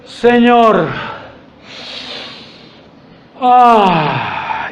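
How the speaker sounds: slow sigh after a word; deep breath. Shown as a demonstration. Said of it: "Primero haga esto".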